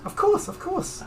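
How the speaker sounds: very high, eager-to-please shopkeeper. Acted. Intimidated shopkeeper agreeing to sell all the oil, high-pitched character voice. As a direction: pipe it up high, obligingly.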